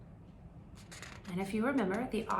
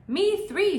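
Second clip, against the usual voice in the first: mouse voice; 12 semitones higher, 10 dB louder than usual. Acted.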